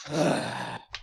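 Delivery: soft, resigned sigh; long sigh. Acted. Narrator gives in to his friend, sighing softly. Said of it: "I let out a long sigh".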